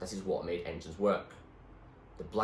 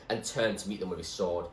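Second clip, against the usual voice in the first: usual speaking voice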